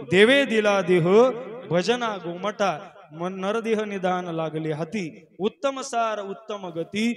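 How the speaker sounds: slow, loud verse recitation; devotional verse. Acted. Preacher backing his moral with scripture, slow declaimed verse. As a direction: declaim the sacred lines with drawn-out words.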